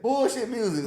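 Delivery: ordinary delivery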